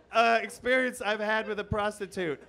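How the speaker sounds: ordinary talk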